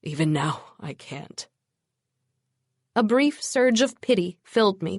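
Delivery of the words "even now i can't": soft, hushed defeated whisper. Acted. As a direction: whisper it, broken. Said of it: "he whispered".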